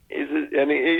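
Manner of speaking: ordinary talk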